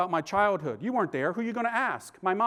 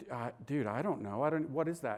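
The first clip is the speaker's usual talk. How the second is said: put-on much softer and lower, embarrassed kid; sheepish mumble; 6 semitones lower, 8 dB quieter than usual. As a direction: mumble it like you've been caught out. Sheepish answer to the priest.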